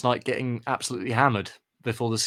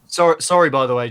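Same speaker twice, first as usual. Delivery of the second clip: ordinary talk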